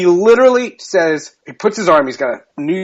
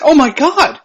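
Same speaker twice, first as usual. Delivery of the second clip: amazed; 11 semitones higher, 4 dB louder than usual. Retold or imitated. Retold amazement.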